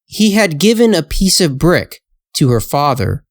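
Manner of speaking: plain reading voice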